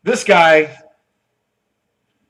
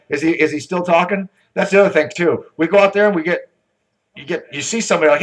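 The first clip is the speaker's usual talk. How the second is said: ordinary delivery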